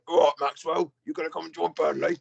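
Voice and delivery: lightly put-on manager voice, quick at the end; a hint of a gruff football manager recruiting a player; fast, mock manager voice inviting a lost player to Burnley; Sean Dyche impression. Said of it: "They've got Sean Dyche gone".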